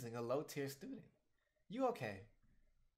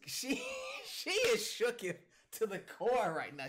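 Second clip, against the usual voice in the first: amused disbelief; 11 semitones higher, 7 dB louder, slower than usual. Real, not acted. Laughing at a character's shock.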